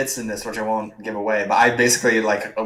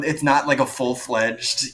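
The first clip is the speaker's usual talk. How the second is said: ordinary talk, nothing marked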